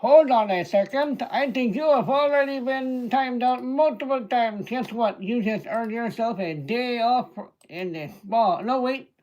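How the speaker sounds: put-on joking Indian accent while kicking a chatter out. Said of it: "Sorry about that accent".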